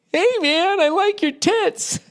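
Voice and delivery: very high, slow hammed-up catcall; cartoonish seventies creep yelling; mock catcaller. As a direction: leer out of the car window.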